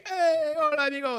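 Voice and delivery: hola, amigo high and loud; high loud mock greeting. Whole house greeting the friendly neighbor: high loud hello. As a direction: mimic their cheerful hello. Family greets him warmly.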